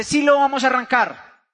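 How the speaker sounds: firm retort, stress on Sí; insistent retold wife. Wife contradicts husband about starting the project, insistent. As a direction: push back with an emphatic Sí.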